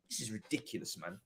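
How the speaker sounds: very low, hushed disbelief; deflated exasperation. Real, not acted. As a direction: drop to a spent murmur. Worn out after the rant.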